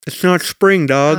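lisping through gold grills; gold teeth lisp. Pretend. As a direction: talk around fake gold teeth. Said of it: "I cannot understand you".

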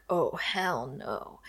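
low, soft, slow dismissive mutter; a lightly voiced annoyed mayor, low and slow; mock disgusted oh hell no. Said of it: "gets this look on his face like".

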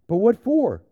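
bewildered protest. A narrator's reading. Boy challenges the lion in story.

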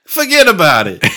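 hammed-up New York catchphrase, pitched high; New York accent. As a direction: play along with a mock New York accent. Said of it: "I'm from New York".

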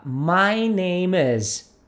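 very slow "My name is."; "My name is." said slowly, each word separate; model pronunciation. Shown as a demonstration. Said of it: "então vamos lá". ("My name is." bem devagar, palavra por palavra)

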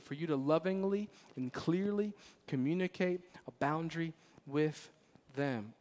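ordinary talk

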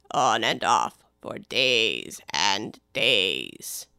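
very low, slow, indifferent drawl; a bored servant, low and slow; slow deep footman. Acted. Footman voice held.

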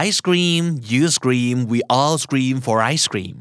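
drawn out slowly, word by word; slow tongue twister line. Shown as a demonstration. Teacher drilling an ice cream rhyme, slow and clear. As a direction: stretch each word for the learners. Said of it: "พูดไปห้าครั้งนะครับ".

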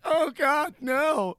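high-pitched imitation of tearful pleading; mock crying. People being pulled away by cops, imitated crying. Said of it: "they're, like, crying and getting".

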